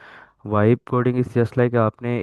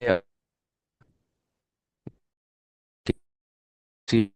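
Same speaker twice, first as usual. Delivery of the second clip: choppy broken-up call audio; words dropping out on bad connection; voice cutting out; 4 dB quieter, much slower than usual. Real, not acted. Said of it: "sir की आवाज़ cut रही है काफ़ी".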